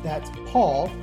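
ordinary talk

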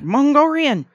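excited. Real, not acted. Echoes the word loudly.

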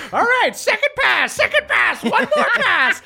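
very high screech, urgent and repetitive; hollering witch. Acted. Witch calls another pass after a miss, hollering. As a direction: screech it like a gung-ho coach. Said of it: "She goes like".

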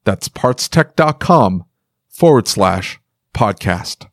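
slow, clear web address; slow URL repeat. Acted. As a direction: spell out the link slowly.

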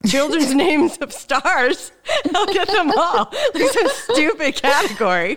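amused, laughing while she talks. Real, not acted. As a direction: giggle your way through the list. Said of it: "That's so funny".

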